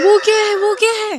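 high, very loud startled cry; alarmed shout. Real, not acted. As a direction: shout in sudden alarm, pitch shooting up. Startled by something unknown. (घबराकर ऊँची आवाज़ में चिल्लाना)

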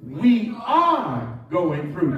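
slow, with ARE stretched and pushed out; stress on ARE. Shown as a demonstration. Preacher leading the church to say it with him, stress on ARE. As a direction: hold a big emphatic ARE before "going through". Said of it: "change it around and watch this".

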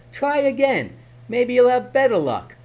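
high, sly coaxing voice; teasing. Acted. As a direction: egg him on playfully. Herschel teases goblin.

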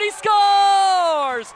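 drawn-out shouted goal call; long goal yell. Real, not acted. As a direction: stretch scores long and loud. Goal call loud held.

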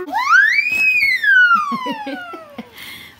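long, very high, loud squeal. Real, not acted. Child screams over a new present, delighted squeal. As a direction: shriek with delight and hold it. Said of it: "Miren la gritadera".

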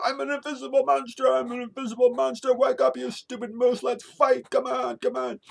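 put-on high, full-volume child's taunting shout; yelled challenge. Little girl challenges the moose to fight, yelling. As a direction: holler it high, spoiling for a fight. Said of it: "She yelled".

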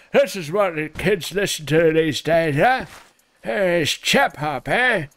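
lightly put-on English gent voice; a hint of a stuffy Englishman; mock posh English. Streamer mocking chap hop as very English, mock posh. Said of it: "It's very English".

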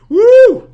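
very high, loud party whoop; excited whoop. Real, not acted. Streamer arriving at the pool party, excited whoop. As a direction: let out a piercing cheer.

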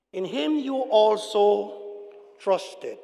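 slow, drawn-out scripture reading. Real, not acted. Preacher reading Paul's mouthful of a verse to church, slow reading. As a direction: read it out slowly, word by word. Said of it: "I'm gonna read it slowly".

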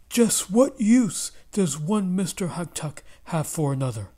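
husband complains angrily about his double; angry cry. Acted. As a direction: snap it out in anger. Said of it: "Mister Haktak cried angrily".

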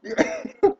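a high burst of laughter at himself. Real, not acted. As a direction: laugh off the rant. Laughs at own rant.

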